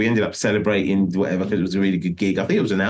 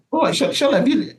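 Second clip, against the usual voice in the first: urgent high call; faster than usual. Retold or imitated. Retelling waking bandmate Shola by phone.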